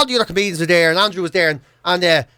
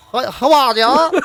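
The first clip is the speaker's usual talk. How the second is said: slow, drawn-out Cork singsong, higher; mock Cork accent; 4 semitones higher, much slower than usual. Portuguese lad imagined greeting people round Cork, mock Cork accent. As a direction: overdo a Cork accent, stretching it out. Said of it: "Portuguese lad walking around Cork".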